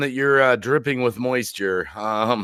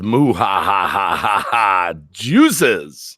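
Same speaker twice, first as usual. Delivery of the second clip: villain laugh; 7 semitones lower, much slower than usual. Pretend. Reading a chat laugh aloud.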